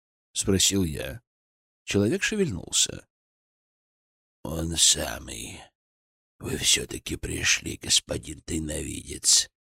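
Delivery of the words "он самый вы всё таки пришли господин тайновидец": put-on low, slow, hollow old man's voice; hollow cracked voice. A narrator's reading. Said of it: "Голос Градова был глухим и надтреснутым". (глухо и надтреснуто, низко)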